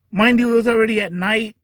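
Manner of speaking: ordinary talk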